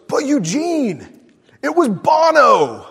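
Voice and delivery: a pushy interviewer, amazed; incredulous interviewer. Retold or imitated. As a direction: press him, you can't believe he said no. Interviewer pressing Eugene in disbelief.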